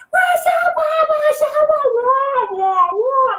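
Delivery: high, loud wah-wah trombone voice; Charlie Brown teacher. Retold or imitated. How adults sound to the child, a high loud wah-wah. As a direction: squawk like the muffled cartoon teacher. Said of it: "That's what you sound like".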